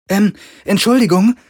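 a thin engineer woman interrupting the men; timid woman calling. A narrator's reading. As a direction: butt in politely. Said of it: "rief eine dünne Frau".

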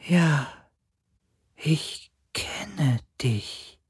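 slow, high, whispery spirit; weak dying voice. A narrator's reading. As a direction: breathe it out faintly, like a dying being. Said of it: "Die Stimme eines sterbenden Wesens". (schwache, sterbende Geisterstimme, gehaucht)

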